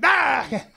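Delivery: sudden loud high "ah"; biting dog snap. Retold or imitated. Dog bites the drunk girl a second time, imitated loud snap.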